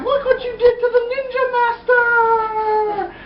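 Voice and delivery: very high, drawn-out falsetto wail; mock anguished cry. Glasses guy mourns the ninja master, mock anguished cry. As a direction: wail the accusation, overdramatic. Acted outraged accusation.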